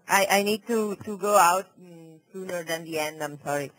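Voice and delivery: ordinary delivery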